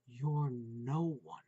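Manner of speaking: slow, soft ghostly whisper from the dark; eerie whisper. A narrator's reading. Voice in the dark taunting Putz, slow eerie whisper. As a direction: breathe it like a hidden voice. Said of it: "a voice whispered from the darkness".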